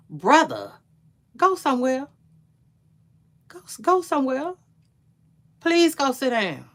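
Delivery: dismissive telling-off. Real, not acted. Host telling [name] to go sit down, exasperated and loud. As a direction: wave him off with exasperation.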